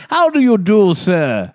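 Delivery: loud, high hammed-up posh English; loud mock-posh British "How do you do, sir". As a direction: bellow it like a toff. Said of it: "That's how they talk".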